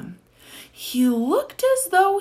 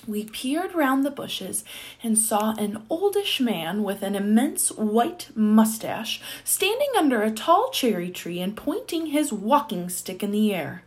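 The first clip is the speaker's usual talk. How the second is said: ordinary delivery, nothing marked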